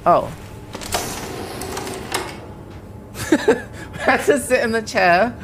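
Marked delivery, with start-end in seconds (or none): 0.4-4.0 soundless wheezing laugh, all air; breathless silent laugh. Real, not acted. Laughing at the exit chair.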